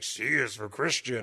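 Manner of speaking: slow, lightly voiced furry monster; a hint of a growly puppet monster; Cookie Monster. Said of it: "as he was, like, Cookie Monster".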